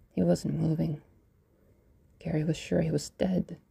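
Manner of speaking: usual speaking voice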